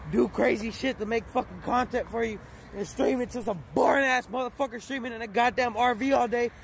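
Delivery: ordinary delivery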